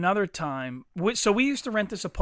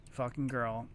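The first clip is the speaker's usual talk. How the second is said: normal talking voice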